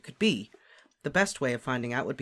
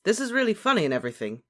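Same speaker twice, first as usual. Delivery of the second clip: fast, high, louder brush-off; impatient; 8 semitones higher, 5 dB louder, faster than usual. A narrator's reading. Harry interrupts lovesick friend, impatient. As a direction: cut him short, hurried and pitched up. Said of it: "said Harry impatiently".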